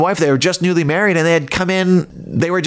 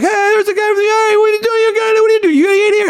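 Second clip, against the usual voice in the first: much higher, louder mock fan voice; excited fans calling out; 4 dB louder, faster than usual. Fans spotting the host outside the bank, high excited calling. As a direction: squeal it like an eager fan on the street. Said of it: "people were like".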